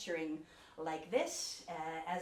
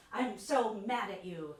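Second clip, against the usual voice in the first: mock angry; 2 semitones higher, 6 dB louder than usual. Shown as a demonstration. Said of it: "indicates who I'm mad at".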